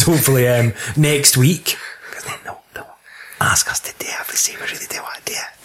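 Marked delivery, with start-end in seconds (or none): 2.1-5.6 quick conspiratorial whisper. Real, not acted. Co-host whispering a sly plan to get requests, hushed. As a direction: drop to a fast hushed whisper, sharing a secret. Secret aside about asking listeners.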